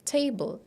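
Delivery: table said as tay-bul, like the furniture word; mock the tay-bul ending; wrong table sound. Shown as a demonstration. Teacher imitating the common mistake. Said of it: "people would pronounce it as table".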